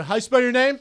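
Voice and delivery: loud, high shouted question; photographer calling out. Retold or imitated. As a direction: call it across the red carpet. Mimicking red carpet photographers.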